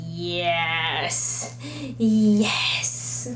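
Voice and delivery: normal talking voice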